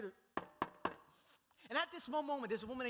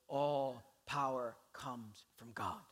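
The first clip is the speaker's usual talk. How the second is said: usual speaking voice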